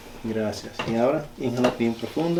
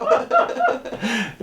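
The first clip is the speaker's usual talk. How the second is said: laughing; 11 semitones higher, 7 dB louder than usual. Real, not acted. Laughs at patient's joke.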